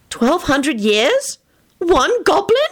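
high, slow incredulous question; startled disbelief. A narrator's reading. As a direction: gasp it in disbelief. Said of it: "a startled look".

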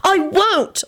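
put-on high, fervent girl's voice; passionate declaration. A narrator's reading. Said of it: "Elizabeth declared passionately".

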